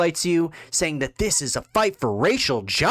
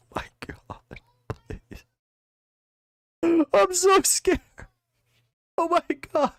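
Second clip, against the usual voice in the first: high, whiny fake-sobbing voice; sarcastic fake weeping over the footage; mock crying; 14 semitones higher than usual. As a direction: ham up being terrified and tearful.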